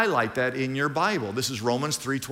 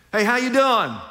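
cheery greeting; 8 semitones higher, 6 dB louder than usual. Pretend.

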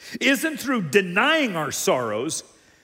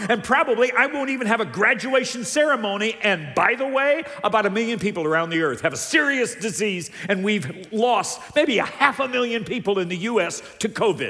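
mounting rant, climbing higher and louder; honest venting teenager; 5 semitones higher, 4 dB louder, faster than usual. Acted. High school senior finally answers the pastor honestly, mounting exasperation. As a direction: pile up grievances, building to an exasperated question. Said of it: "if they'd answered me honestly".

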